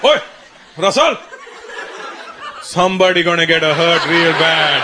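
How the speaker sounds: put-on accented father making an ominous threat; dad's threat. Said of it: "That was my dad's threat".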